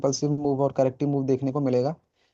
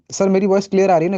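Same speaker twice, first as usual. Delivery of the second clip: checking audio; 4 semitones higher, 6 dB louder, faster than usual. Real, not acted. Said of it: "थोड़ा सा मैं धीरे बोल रहा हूँ".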